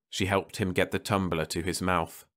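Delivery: ordinary delivery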